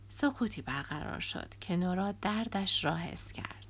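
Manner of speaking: ordinary delivery, nothing marked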